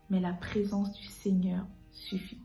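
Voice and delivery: normal talking voice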